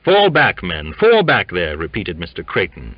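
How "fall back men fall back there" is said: loud, raised command; stern officer calling out over men; stern order. A narrator's reading. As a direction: order a crowd back firmly. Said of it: "sternly pushing through".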